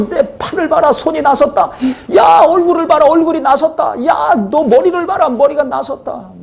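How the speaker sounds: high, laughing cries of joy; joyful shouting. Retold or imitated. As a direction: shout it out like a healed man overjoyed. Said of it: "막 소리소리 지른 겁니다". (기뻐서 웃으며 높게 외치는 목소리)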